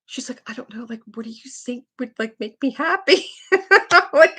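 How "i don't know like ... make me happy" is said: put-on small, high, hushed child voice; mock weepy little girl; imitated crying. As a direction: ask meekly through pretend tears. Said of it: "she's just crying".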